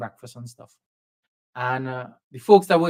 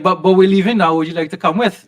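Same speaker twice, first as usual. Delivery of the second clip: loud, high and eager; excited invitation; 5 semitones higher, 9 dB louder than usual. Retold or imitated. Interviewer excitedly inviting him to come along, loud and eager. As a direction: call out the offer with enthusiasm.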